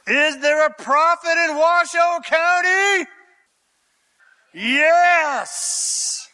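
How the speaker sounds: fired-up preaching call. Acted. Preacher calls out to congregation.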